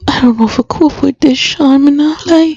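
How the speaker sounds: high-pitched, upset and struggling to cope; tearful. Acted. Grieving mother confiding in Sean, tearful. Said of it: "I can't stop crying".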